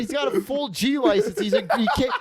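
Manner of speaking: high-pitched, worked-up ranting through laughter; real hilarity, voice climbing high; incredulous ridicule. Real, not acted. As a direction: pitch way up in amused disbelief.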